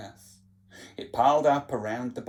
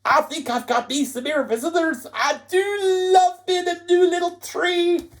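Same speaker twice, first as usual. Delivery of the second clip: triumphant Tatty Bogle; 7 dB louder than usual. Acted. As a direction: crow about coming back.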